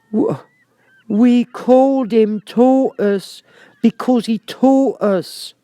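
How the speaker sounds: indignant high creature voice, slowed; angry Mock Turtle. A narrator's reading. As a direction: let the turtle bristle, drawn out. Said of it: "said the Mock Turtle angrily".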